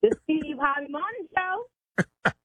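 high, slow, drawn-out show name; in her own tone. Pretend. Pranked interpreter naming the show on request, slow high tone. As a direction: stretch the name out, high and deliberate. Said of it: "Tell me in your tone".

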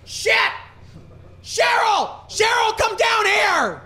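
mock-furious dad shouting for his wife; dad yelling. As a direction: bellow up the stairs like an enraged father. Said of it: "my dad pounding on the counter, going".

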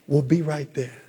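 operator reply. Retold or imitated. A hint of the operator reassuring the trapped man, low and calm. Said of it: "operator reply".

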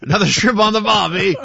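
loud, high hammed-up Aussie; bad Australian accent. Sports anchor joking about a game down under in a bad Australian accent. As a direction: overdo a cliché Australian accent. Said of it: "What accent was that?".

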